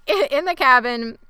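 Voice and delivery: ordinary talk, nothing marked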